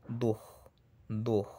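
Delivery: du as "dukh", breathy velar ending; correct final sound. Shown as a demonstration. Teacher correcting English-style du, ending in "kh". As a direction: finish du on a raspy "kh".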